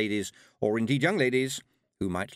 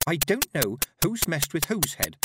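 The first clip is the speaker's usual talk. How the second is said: slow, emphatic retort in a higher voice; indignant comeback; snapped; 7 semitones higher, 3 dB louder than usual. A narrator's reading. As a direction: snap back at a rude friend. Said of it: "snapped Petulia".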